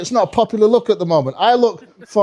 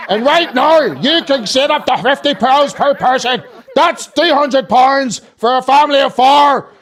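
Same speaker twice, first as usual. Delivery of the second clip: high, slow mock Northern Irish advert; hammed-up Northern Irish sales bellow; Northern Irish accent; 6 semitones higher, 3 dB louder, slower than usual. Girlfriend reciting UK advert, belted Northern Irish accent. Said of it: "so her accent is fucked".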